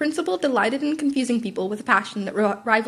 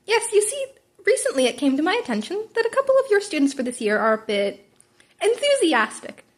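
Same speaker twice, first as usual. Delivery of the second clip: playful principal Nezu; 7 semitones higher than usual. A narrator's reading. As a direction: tease the tired teacher, lilting. Nezu voiced in story.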